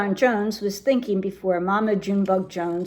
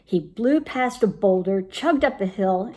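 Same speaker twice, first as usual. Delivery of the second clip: plain reading voice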